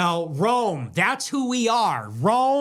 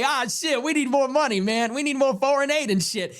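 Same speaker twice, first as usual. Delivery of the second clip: higher, mocking voice of a leader asking for aid; mocking foreign leader voice; 5 semitones higher than usual. Mocking an African leader at the UN, higher and whiny. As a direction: ham up the whiny plea for more money. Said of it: "get up there and say".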